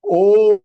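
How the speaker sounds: O held long as "oooh"; triple-length vowel. Shown as a demonstration. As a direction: sustain the O for three beats. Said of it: "O three times".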